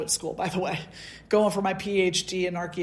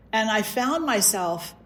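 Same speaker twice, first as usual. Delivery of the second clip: ordinary delivery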